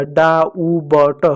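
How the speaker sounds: डा उ ब ट very slow, piece by piece, with the b voiced; wrong reading, sounded out. Shown as a demonstration. Teacher showing the mistake, डा उ ब ट slowly with b sounded. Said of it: "गलत हो जा रहा है".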